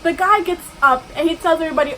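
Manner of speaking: usual speaking voice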